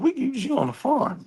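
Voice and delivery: lightly voiced old woman marvelling at "big guy"; mock old lady.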